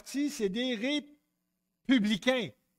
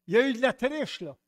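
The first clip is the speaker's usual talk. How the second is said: raised, indignant mock protest; a hint of an angry candidate; mock outraged politician; 5 semitones higher, 4 dB louder than usual. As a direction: play the sore loser crying foul. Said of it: "en disant".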